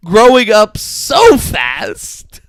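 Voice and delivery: very slow, loud, high mock gush. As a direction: stretch each word in an over-the-top shout. Repeats the mimicked gushing line.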